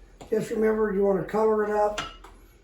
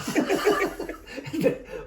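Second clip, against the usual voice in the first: laughing; 7 semitones higher than usual. Real, not acted. Caught out by the whistleberries joke.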